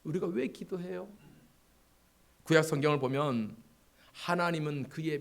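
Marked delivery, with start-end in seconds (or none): none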